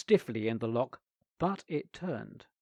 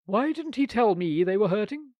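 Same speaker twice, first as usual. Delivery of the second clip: higher and louder, tart aunt; sharp asperity; 7 semitones higher, 7 dB louder than usual. A narrator's reading. Aunt asks about Bobby's boots, sharp and tart. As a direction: snap the question back with irritation. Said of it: "asked the aunt with some asperity".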